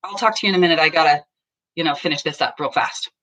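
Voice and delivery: rushed, fast, busy fobbing off; mock distracted spouse, talking fast; distracted brush-off. Shown as a demonstration. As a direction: hurry through it, half listening. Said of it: "That's turning away".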